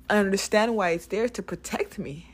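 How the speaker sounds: ordinary talk, nothing marked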